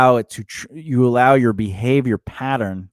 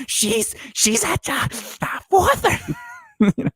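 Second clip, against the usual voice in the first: high squawky penguin voice with laughs; squawky bouncy penguin; 9 semitones higher than usual. Pretend. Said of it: "That's my penguin impression".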